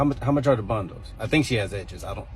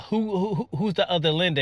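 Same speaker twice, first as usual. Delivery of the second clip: shocked; 7 semitones higher than usual. Real, not acted. Teacher shocked by kid's question.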